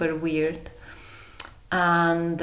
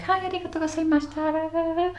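high, fast, sing-song Japanese greeting; bright, rapid bowing greeting; imitating lift attendant; 11 semitones higher, much faster than usual. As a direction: chirp it like a polite lift attendant. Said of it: "the next floor she was like".

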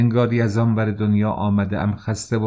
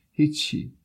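put-on small, high-pitched crying boy; high voice of a tearful boy, brushing it off; 8 semitones higher than usual. A narrator's reading. Weeping boy answers the worried stranger, tearfully. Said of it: "صدای گریهٔ پسرک بلند شد".

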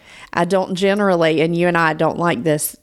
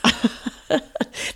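laugh; 7 semitones higher, 5 dB quieter than usual. Real, not acted. Laughs at own self-deprecating joke.

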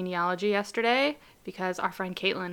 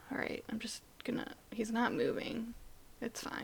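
hushed whisper to herself; 16 dB quieter than usual. Real, not acted. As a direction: whisper so the spider won't hear. Wary of the spider.

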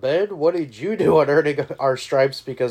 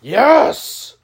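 excited shout; 11 semitones higher, 11 dB louder than usual. Real, not acted. Celebratory shout.

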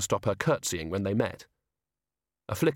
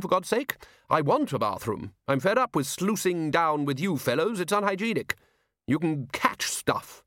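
higher, indignant bluster; blustering Ridcully; 6 semitones higher than usual. Acted. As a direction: complain like an outraged old wizard. Ridcully rants for a bathroom.

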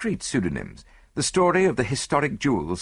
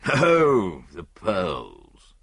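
hearty husband, rambling and trailing off; self-satisfied stockbroker; 2 semitones lower, much slower than usual. Acted. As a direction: ramble complacently about money, uneven pitch.

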